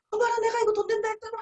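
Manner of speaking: excited gush. Retold or imitated. Partner overexcited at the guest's interest, fast gushing. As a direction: blurt it out, overexcited. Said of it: "제일 흥분하십니다".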